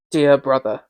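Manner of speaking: usual speaking voice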